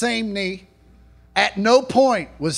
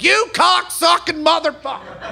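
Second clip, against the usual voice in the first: high, hammed-up furious yell; mock furious; 8 semitones higher than usual. Comic mocking news viewers, high mock yell. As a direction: rage like a cable news fan. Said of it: "everybody's like".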